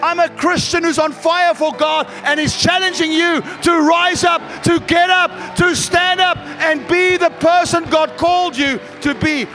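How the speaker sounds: loud, high fiery preaching shout; on fire, rally them to rise; booming motivational speech. Real, not acted. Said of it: "you sound like a motivational speaker".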